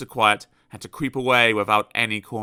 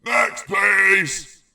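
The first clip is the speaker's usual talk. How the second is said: much higher, very loud call; shouting next; 8 semitones higher, 6 dB louder than usual. Acted. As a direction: dismiss him with a yell. Shouting shopkeeper.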